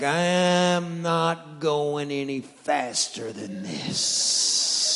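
very slow, sing-song dog. Retold or imitated. Dog refusing to hurry, slow sing-song. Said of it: "He's like".

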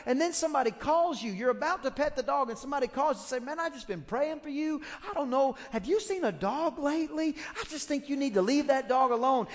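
high, loud preaching climax; preaching at full force. Acted. Preacher building to a shouted climax before the congregation. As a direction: build it to a shouting altar call.

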